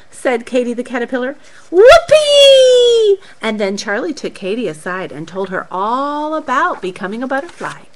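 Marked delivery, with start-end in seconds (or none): 1.7-3.2 joyful whoop. Acted. Katy overjoyed.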